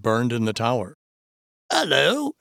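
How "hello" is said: high, startled character voice; exclaimed in surprise. A narrator's reading. First man spotting the light in the tower, exclaiming. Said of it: "exclaimed the first man".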